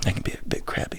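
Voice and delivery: whispered, sheepish admission; whispered confession. Real, not acted. Admitting his temper to the host, whispered. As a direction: confess it under your breath.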